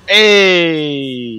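loud, drawn-out hey; called out. Real, not acted. Player yelling out mid-game, loud shout. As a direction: holler it out in one long call. Calls out amid play.

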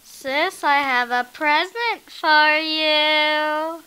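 loud slow call; sing-song call across distance. Acted. Calling sister with present.

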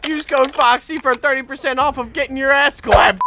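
high, loud hammed-up sponsor plug; mock promo shout. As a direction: yell it like a joke ad. Mock promo yelled at a kill.

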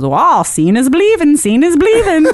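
playful mock Irish accent; terrible Irish accent. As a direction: do a bad Irish coworker. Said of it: "That's a terrible Irish accent".

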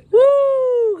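loud high cheer; excited whoop. Real, not acted. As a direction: whoop from the rush. Adrenaline rush.

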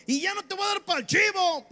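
high, stretched defiant yell; grito bravucón. Acted. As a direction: holler the threat slowly and very high. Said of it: "usted grítele". (amenaza gritada, aguda y alargada)

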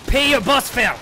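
high angry yell; furious shout. Real, not acted. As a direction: bellow the parting shot. Yelled parting shot.

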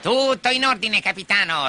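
high-pitched dutiful reply; pirate henchman. Acted. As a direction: report to the captain in a high voice. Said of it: "replicò Smee".

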